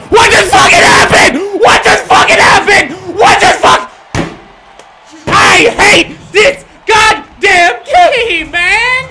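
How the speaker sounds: screaming slow rage; full-throated furious yell; raging gamer. Real, not acted. Game loss rage.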